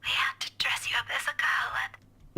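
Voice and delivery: whispered. Acted. Becca reminiscing to Ash over the phone, whispering. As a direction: keep it a hushed breath.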